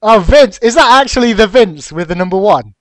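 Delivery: loud, high, incredulous question; excited surprise. Real, not acted. Caster recognises the raffle winner, loud excited surprise.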